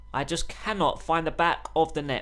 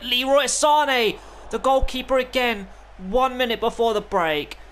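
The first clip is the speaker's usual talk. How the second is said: excited shout; 8 semitones higher, 4 dB louder than usual. Real, not acted. Player calling a late first-half chance, excited shout. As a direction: yell it like the goal is coming.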